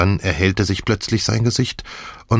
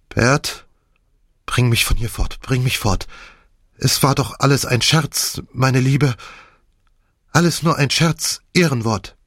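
higher, pleading old woman, lightly voiced; flustered old woman; 5 semitones higher than usual. A narrator's reading. Misses Croft begging her husband to take her away, flustered. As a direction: beg to be taken away, brushing it off.

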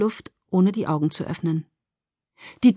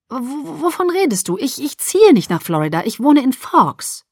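stammering, louder disbelief; flustered protest; 6 semitones higher, 3 dB louder than usual. Acted. Daughter refusing move to Florida, flustered protest. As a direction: stumble into a baffled objection.

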